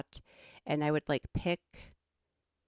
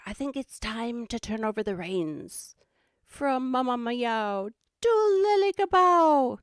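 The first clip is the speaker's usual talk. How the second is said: high, hammed-up Aussie host handover; mock wildlife-show announcer, rising to a squeal; Steve Irwin; 7 semitones higher, 7 dB louder than usual. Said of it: "channel my inner Steve Irwin".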